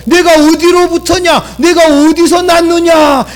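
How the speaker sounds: preacher mimicking Pilate's pompous question; mock lofty question. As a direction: put on fake refinement. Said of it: "고상한 척하고".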